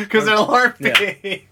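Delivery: cracking up while repeating the punchline; laughing echo. Real, not acted. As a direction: laugh the line back at him. Friend echoes punchline, laughing.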